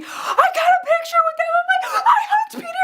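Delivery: very high excited fangirl squeal; freaking out. Retold or imitated. Fan telling another fan about hugging Peter, freaking out. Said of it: "freaking out together".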